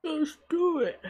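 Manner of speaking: sleepy, yawning motivational cry; tired version. Shown as a demonstration. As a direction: say it through a yawn. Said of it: "That's the, the tired version".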